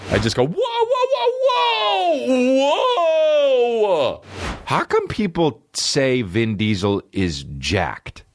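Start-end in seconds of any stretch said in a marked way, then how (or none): 0.5-4.2 high, rising wail of whoas; drawn-out whoa. Acted. Comedian acting out his own reaction, drawn-out high wailing. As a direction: stretch the whoas into a wail that falls away.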